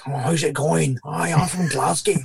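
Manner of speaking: put-on light Glaswegian accent, slowing at the end; playful Glaswegian accent impression; Glasgow accent. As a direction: lean into a Glasgow accent for fun. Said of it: "when I was in Scotland, I'm like".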